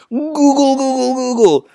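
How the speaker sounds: high, gurgling Wookiee warble; comic creature noise, held up high; Chewbacca. Acted. Chewie replies to Han, in a high warbling gurgle. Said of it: "And Chewie says".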